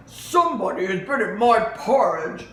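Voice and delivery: loud, gruff indignant bear; big bear's booming complaint; papa bear. Acted. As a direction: growl out the discovery. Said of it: "said Papa Bear".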